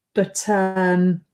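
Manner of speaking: burp mid-sentence. Real, not acted. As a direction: talk through a small burp. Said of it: "excuse me, I'm burping".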